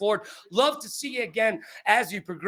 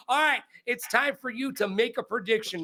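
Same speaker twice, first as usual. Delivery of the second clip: usual speaking voice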